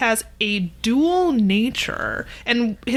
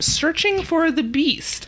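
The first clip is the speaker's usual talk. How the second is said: mock ominous; 6 semitones higher, slower than usual. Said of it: "quote, unquote".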